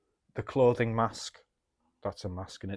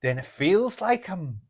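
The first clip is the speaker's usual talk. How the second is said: easing into a louder Scouse accent; 4 semitones higher, 5 dB louder than usual. Shown as a demonstration. Showing a friend an accent as a mask, getting into Scouse. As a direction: try on a Scouse accent, laughing a little. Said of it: "get into the accent of Scouse".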